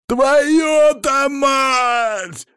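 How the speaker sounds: very high, drawn-out shout; pained yell. A narrator's reading. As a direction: scream as you're bitten. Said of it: "заорал он".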